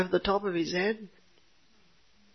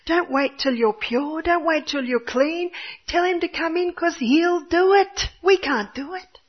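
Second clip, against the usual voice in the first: preaching; 7 semitones higher than usual. Real, not acted. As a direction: urge them to let God in. Preacher exhorting the congregation.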